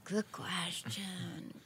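slow whispered musing. Real, not acted. Pondering a makeup question in a slow whisper. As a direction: breathe it out slowly, mulling. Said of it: "whispered musing before answering".

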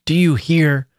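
insistent demand. A narrator's reading. Girl shaking a silent man, insistent demand. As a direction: demand an answer. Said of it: "she repeated, shaking him".

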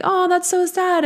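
high, sugary mock pity; lightly mocking high sympathetic voice; mock sympathetic listener. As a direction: coo it like a pitying fan. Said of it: "you guys are probably like".

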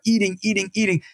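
ordinary delivery

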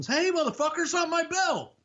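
very high, squeaky mock protest; mock indignant Ted. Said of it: "I'm like".

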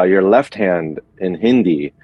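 usual speaking voice

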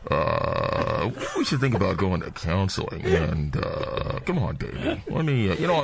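pilot voice. Acted. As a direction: soothe her in a calm captain voice. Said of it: "why do you use that goddamn voice".

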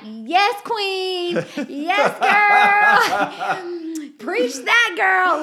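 high, loud whooping hype with laughter; cheering friends. Retold or imitated. Friends cheering her post: high excited shouting. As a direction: shout it like hyped-up girlfriends. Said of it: "They were like".

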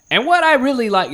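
put-on high, earnest kid reviewer voice; mock teen critic. Mocking the teen film reviewer in a high kid voice. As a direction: ape a precocious thirteen-year-old. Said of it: "and he's like".

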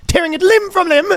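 high, hammed-up Irish wail; mock Irish lament, climbing high; bad Irish accent. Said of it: "That's a bad Irish accent".

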